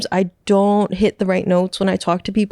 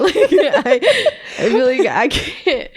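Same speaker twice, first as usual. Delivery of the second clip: laughing; 4 semitones higher, slower than usual. Real, not acted. Laughing about sarcasm.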